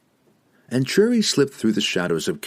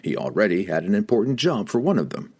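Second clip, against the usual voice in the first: plain reading voice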